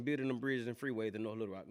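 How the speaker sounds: usual speaking voice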